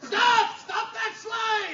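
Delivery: high ringing shout after the sleigh; shouting stop. Acted. Cop chasing a runaway sleigh, shouting. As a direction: yell it from down the street.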